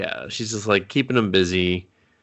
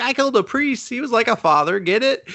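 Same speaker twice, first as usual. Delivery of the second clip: put-on raised, playful teen voice; high giddy voice; 13 semitones higher than usual. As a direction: pitch it up and tease. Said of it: "she's like".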